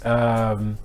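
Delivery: normal talking voice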